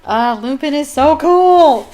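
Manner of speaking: loud, drawn-out fan enthusiasm; gushing admiration. Real, not acted. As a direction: gush slowly, building to a loud finish. Gushes over character after reading.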